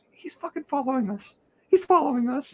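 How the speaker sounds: high, hushed squeal; frantic friend. Retold or imitated. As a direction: imitate an excited friend whispering urgently. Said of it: "that kind of thing".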